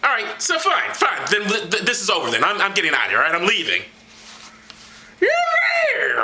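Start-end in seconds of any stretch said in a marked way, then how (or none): 5.2-6.2 high, indignant whiny apostle; high Paul voice. Acted. Paul snaps back at Barnabas, high and offended.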